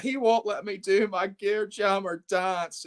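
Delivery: high, slow mock whine; hammed-up tearful protest; imitating crying complaint. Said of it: "he started crying".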